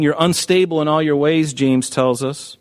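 ordinary delivery

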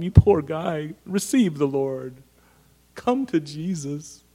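syrupy church-lady sympathy; a hint of a gushing churchgoer; mock pious consoler. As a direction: coo over him like a pious comforter. Church people fussing over Saul.

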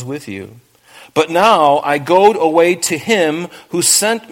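measured, solemn Bible reading; scripture reading. Acted. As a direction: read the verses with weight, slowing on key lines. Reads scripture aloud in sermon.

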